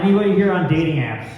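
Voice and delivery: usual speaking voice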